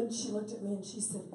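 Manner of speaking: ordinary delivery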